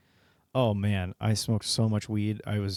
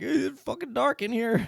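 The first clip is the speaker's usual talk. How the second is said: much higher, slow squeaky mock complaint; dead grandpa complaining; 13 semitones higher than usual. Retelling the funeral tape to a friend, slow squeaky whine. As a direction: whine it from inside the coffin in a silly squeak. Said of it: "And it's like".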